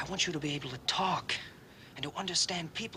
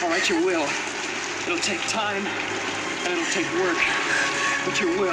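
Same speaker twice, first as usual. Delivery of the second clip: elated; 4 semitones higher, 10 dB louder than usual. Real, not acted. Elated promise in the rain.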